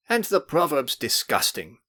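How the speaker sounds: a touch of exasperated protest; indignant. A narrator's reading. Gloomy young man sulking after failing, mildly indignant. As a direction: grumble it with offended pride. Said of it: "exclaimed indignantly".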